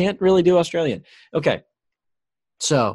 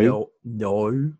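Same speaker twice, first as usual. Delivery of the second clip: Australian no; 4 semitones lower than usual. Shown as a demonstration. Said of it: "You got it better than I do".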